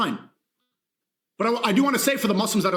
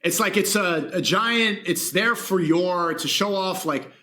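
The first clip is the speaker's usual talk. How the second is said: ordinary delivery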